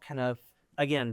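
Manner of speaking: ordinary talk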